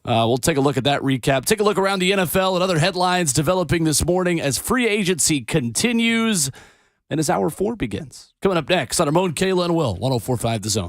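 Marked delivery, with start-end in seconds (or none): 1.5-10.9 upbeat announcer sign-off, drawing out continues; radio outro read. Acted. Host teasing the next hour to listeners, announcer read. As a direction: sell the next segment like a station promo.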